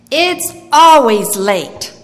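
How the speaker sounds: put-on slow, loud old woman calling out of the snow; a stranger's voice, drawn out and heavy; old lady's voice. A narrator's reading. Old lady on the bench greets the shivering boy, slow and loud.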